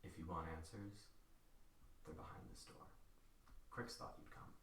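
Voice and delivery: low, very soft, near-whisper; hushed unmasked stranger. Acted. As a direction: give the cryptic answer under your breath. GM voices the unmasked man.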